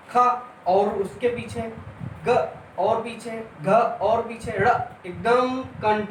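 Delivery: slow, drawn out, moving each sound further back; walk the class slowly through खा ग घ ड़; slow demonstration. Shown as a demonstration. Teacher slowly demonstrating sounds moving back to the throat. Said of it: "यहाँ से कंठ से बोल रहे हैं".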